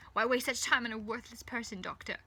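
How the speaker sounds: loud, mildly exasperated retort; huffy. A narrator's reading. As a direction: huff it back at a bully. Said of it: "she huffs".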